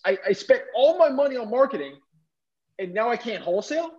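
high, stumbling disbelief; retold dismay. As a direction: protest the bad news, rising into a question. Teen realises he cannot wholesale.

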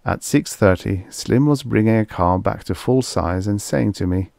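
plain reading voice

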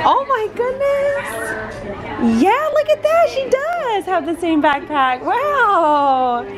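high, drawn-out amazement; real high-pitched delight; gushing mom. Real, not acted. Mom gushing at child's news.

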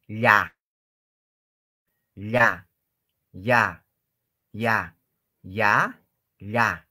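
"Ya" said slowly and clearly, one word at a time; careful ya. Shown as a demonstration. Teacher drills the students on the ya sound, slow and clear. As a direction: repeat "Ya" as a clear model for the class. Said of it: "la pronunciación de ya".

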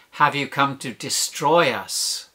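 slow, falling accusation; slow, measured mock outcry; demon's accusing cry. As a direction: draw it out as an accusation, falling at the end. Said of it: "the demon makes him cry out".

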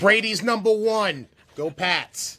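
loud, high Boston sports fan; Boston accent. Acted. Host plays a Boston fan rooting for the team, in accent. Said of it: "That's Boston, right?".

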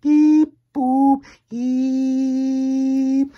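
very high, very loud beeping; silly robot sounds. Acted. Reader forced to make silly sounds, high loud squeals. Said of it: "make silly sounds like".

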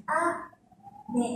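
syllable-by-syllable falling "Ame"; slow high-to-low accent. Shown as a demonstration. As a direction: draw out "Ame", stepping down in pitch. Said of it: "Mình sẽ đọc từ trên xuống".

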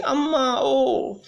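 high drawn-out begging cry; a hint of a wailing beggar; beggar's wail. A narrator's reading. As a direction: wail it from the gate. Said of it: "he was wailing".

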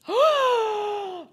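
shocked gasp. Acted. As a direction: gasp at a startling arrival. Sees Von Karma.

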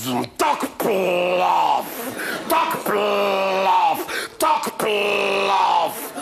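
slow, drawn-out tak plaf; mock sting sound. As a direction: stretch out the sting like a smack. Insect sting imitated.

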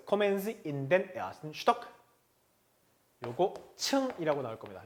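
ordinary delivery

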